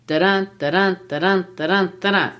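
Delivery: "da-dun" pulsed like a heartbeat, strong on "dun"; light "da", heavy "dun", evenly repeated; heartbeat rhythm. Shown as a demonstration. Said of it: "it replicates the human heart".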